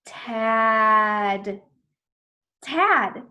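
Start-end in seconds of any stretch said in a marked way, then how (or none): 0.0-1.6 really long sounds. Shown as a demonstration. Teacher shows how to blend, Tad really long. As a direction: stretch out Tad loudly. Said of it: "I said the sounds really long".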